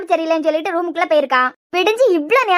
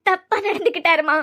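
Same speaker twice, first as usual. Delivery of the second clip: sobbing confession. Acted. Daughter accusing him to mother, sobbing confession. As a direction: force it out between sobs.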